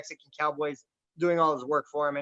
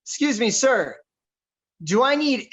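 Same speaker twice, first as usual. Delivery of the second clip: loud, higher call across the line; boisterous ask; 5 semitones higher, 5 dB louder than usual. Retold or imitated. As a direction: boom it out to the guy. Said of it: "I said".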